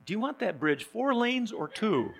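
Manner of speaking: the genie caves at once; genie punchline. Retold or imitated.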